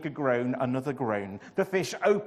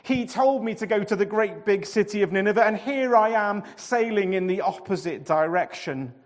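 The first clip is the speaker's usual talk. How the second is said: groaning confession; 6 semitones higher, 9 dB louder than usual. A narrator's reading. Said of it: "Jonah groaned".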